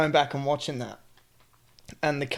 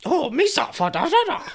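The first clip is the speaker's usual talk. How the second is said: much higher, louder goofy cartoon voice with a laugh; bad high Jar Jar impression; Jar Jar Binks voice; 13 semitones higher, 5 dB louder than usual. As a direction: squeak it out like a clumsy alien. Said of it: "That's not how he sounds".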